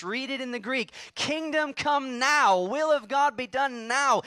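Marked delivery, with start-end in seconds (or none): 1.1-4.2 Kingdom come now shouted louder, NOW hammered; emphatic command. Shown as a demonstration. Preacher showing the Greek meaning of the prayer, commanding. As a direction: command it forcefully, slow and loud. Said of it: "It's an emphatic command in the Greek".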